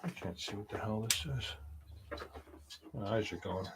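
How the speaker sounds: ordinary delivery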